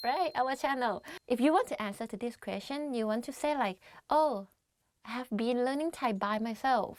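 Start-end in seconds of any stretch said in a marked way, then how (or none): none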